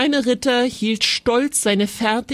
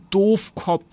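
ordinary delivery

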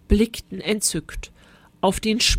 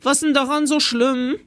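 put-on cheeky boy; 6 semitones higher, 3 dB louder than usual. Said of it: "fragte der freche Fred".

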